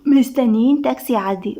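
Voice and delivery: plain reading voice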